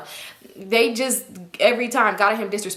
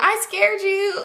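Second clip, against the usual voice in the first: high-pitched mimicked kid taunt; gleeful little girl; 11 semitones higher, much slower than usual. Little girl popping out from under car, gleeful mimicry. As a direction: imitate a child springing a prank, pitch up. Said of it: "come out laughing".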